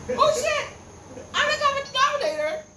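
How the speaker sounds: high, loud burst of discovery; a high, loud oh-shit moment of realisation; shocked realisation. Retold or imitated. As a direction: blurt it out, suddenly getting it. Sudden realisation, retold.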